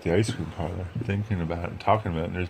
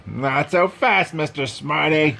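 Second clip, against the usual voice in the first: stern coach; 6 semitones higher, slower than usual. Acted. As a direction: stop the kid in his tracks. Coach grabs fleeing fullback.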